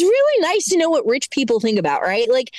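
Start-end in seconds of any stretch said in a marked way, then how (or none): none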